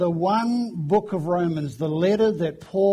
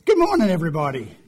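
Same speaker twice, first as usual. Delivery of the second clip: big hearty greeting; 5 dB louder, much faster than usual. Shown as a demonstration. Preacher rousing a friendly church congregation, big hearty greeting. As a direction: call it out to the whole room. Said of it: "I very rarely sort of do a".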